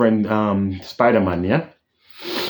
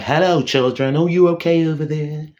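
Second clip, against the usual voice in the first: higher, polite mock English accent; 5 semitones higher than usual. Fan mocking the Thing's new voice, mock English accent. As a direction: put on a prim English accent, lifting higher. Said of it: "Not like".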